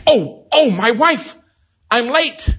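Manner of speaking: high, loud startled cry; sudden alarm. Retold or imitated. Young man realizing he is late for his wife, high startled cry. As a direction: jolt up in a panic at the time. Glances at watch, realizes lateness.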